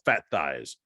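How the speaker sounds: low mimicked voice of a word box; electronic voice box. Ghost gadget speaking up to its owner, low electronic voice-box impression. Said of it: "an electronic voice box".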